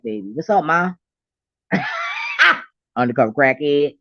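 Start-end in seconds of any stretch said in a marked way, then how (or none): 1.7-2.6 a high shrieking laugh; a sudden high squeal of laughter. Real, not acted. Laughs at crack joke.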